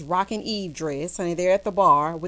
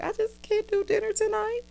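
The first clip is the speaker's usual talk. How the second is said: high, softly whiny fake crying; mock tearful; 12 semitones higher, 3 dB quieter than usual. Imitating Karen bailing on dinner, mock tearful high whine. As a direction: imitate a weepy plea, pitched way up. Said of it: "Karen starts crying".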